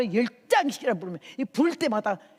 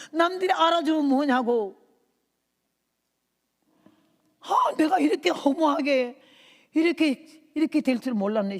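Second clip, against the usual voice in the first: wailing lament; 6 semitones higher, 6 dB louder than usual. Retold or imitated. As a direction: cry it out in bitter regret. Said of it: "이내 가슴에 통곡이 터져서".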